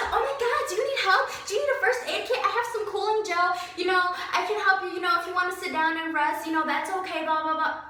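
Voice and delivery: mock the boss sucking up in a high sing-song; fake-sweet boss. Boss suddenly sweet to mom.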